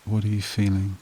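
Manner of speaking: even, level meditation voice with long pauses; guided meditation. Acted. Therapist guiding listeners back through a painful memory, guided meditation voice. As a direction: guide the listener steadily, leaving long silences between prompts.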